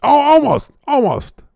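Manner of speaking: high excited yelp; excited near miss. Real, not acted.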